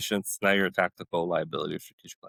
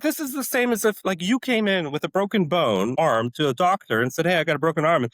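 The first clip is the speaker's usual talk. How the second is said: high, raised voice arguing the point; indignant retold rant; 7 semitones higher, 4 dB louder than usual. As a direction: argue it hotly with an analogy. Retold indignant argument to staff.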